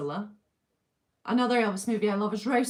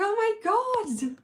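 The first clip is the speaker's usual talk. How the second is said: much higher, slow, stretched-out gasp of disbelief; stunned oh my God; 12 semitones higher, much slower than usual. Retold or imitated. Retelling police checking on her, high stunned disbelief. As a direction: draw it out, high and amazed.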